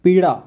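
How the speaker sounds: पीड़ा said with a flapped ड़ in place of the l of पीला; पीड़ा, loud, with ड़ flapped; demo pronunciation. Shown as a demonstration. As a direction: say पीड़ा with a retroflex flap ड़. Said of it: "इसको क्या पढ़ते हैं?".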